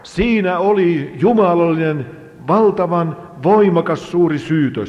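match the preacher's climax with a powerful voice; full-force preaching. Real, not acted. (täydellä voimalla saarnattu)

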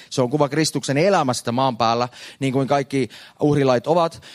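ordinary delivery

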